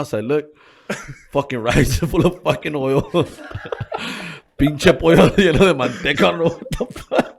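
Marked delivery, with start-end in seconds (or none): 1.3-7.3 high and loud, words broken by laughter; laughing through it. Real, not acted. Telling a friend about mom's oily chicken, laughing hard. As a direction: laugh helplessly while telling it.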